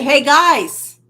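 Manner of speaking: loud, breezy "Hey, guys"; casual loud greeting. Retold or imitated. Jesus greeting the disciples the wrong way, loud and casual. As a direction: toss it off like a buddy. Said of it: "He didn't say".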